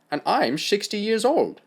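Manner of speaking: German doctor boasting; German accent. Acted.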